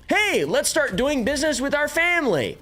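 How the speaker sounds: high, hammed-up schemer voice; mock Clinton voice. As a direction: squeak it out like a smug insider. Mocking the Clintons' nepotism.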